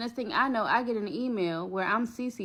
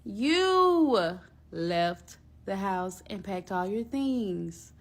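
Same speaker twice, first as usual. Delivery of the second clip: slow, mocking clapback; sarcastic; slower than usual. Real, not acted. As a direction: drag it out slowly with sass. Mocking the girlfriend's accusation.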